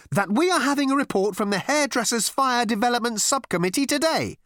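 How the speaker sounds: high, insistent strident announcement; firm. A narrator's reading. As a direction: press on firmly, pitched right up. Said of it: "continued the girl firmly".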